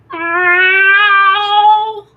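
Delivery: high, loud mock cat meow; imitated cat meow. Owner mimics her cat yowling outside the bathroom, mock meow. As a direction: do the cat's whiny ow at the door. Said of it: "and he'll be like".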